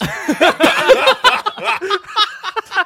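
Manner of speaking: loud, high cackle of laughter. Real, not acted. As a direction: explode laughing at the punchline. Punchline "like pros.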